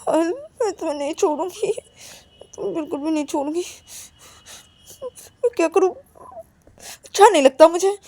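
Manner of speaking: high, whining, crying voice; tearful outburst. Acted. Acted tearful outburst after being rebuffed. (रोते हुए, रुआँसी आवाज़ में)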